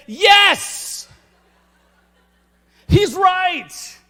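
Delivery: high, loud yell of yes; shouted agreement. Retold or imitated. Dad backing up his son from the driver's seat, excited shout. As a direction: cheer him on at full voice.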